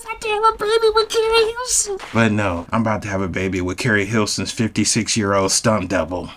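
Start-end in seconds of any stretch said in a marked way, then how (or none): 0.1-2.0 tiny falsetto squeal of pretend joy; mock delight. Man imagining a baby with celebrity crush, mock delighted. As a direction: squeal it very high, overjoyed.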